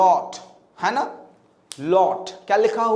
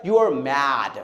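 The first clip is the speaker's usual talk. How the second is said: lower, slow, rounded foreign-sounding English; imitating a fluent foreigner's English accent; posh foreigner English; 3 semitones lower, much slower than usual. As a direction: put on a polished native English speaker's voice. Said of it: "कोई अच्छी English बोलने वाला बोलता है".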